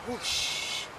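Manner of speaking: soft breathy blowing whoosh. A narrator's reading. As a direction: blow like the wind. Wolf blows house down.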